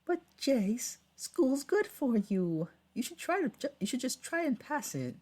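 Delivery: high, loud British mother; British lady voice. Acted. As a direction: play a pleading British mum, high and loud. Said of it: "All my women voices turned into British-sounding ladies".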